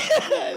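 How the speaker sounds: a sudden squeaky laugh that jumps way up; high burst of laughter. Real, not acted. Recalling tormenting toads, a high squealing laugh. Laughing at own childhood cruelty.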